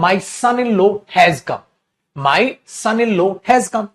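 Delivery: each word spaced out slowly for the class; slow dictation. Shown as a demonstration. Teacher dictating an example sentence slowly to students. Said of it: "तो वो क्या बोलेगा?".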